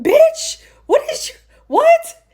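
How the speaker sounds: very high, cracking cry of disbelief; outraged disbelief. Retold or imitated. Vlogger reacting to Carrie's words, shrieking disbelief. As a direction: react as if you can't believe your ears. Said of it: "I was like".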